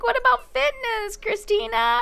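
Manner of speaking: high, prim mock question; voicing a sim. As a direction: squeak it out like a nosy sister. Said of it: "She's like".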